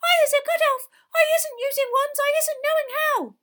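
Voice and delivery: very high, loud frantic squeaks; terrified squealing elf. A narrator's reading. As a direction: wail your innocence as a tiny terrified elf. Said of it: "she squealed, her eyes rolling in terror".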